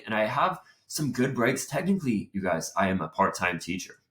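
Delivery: ordinary talk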